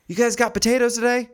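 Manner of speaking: eager shopper. Retold or imitated. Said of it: "She goes".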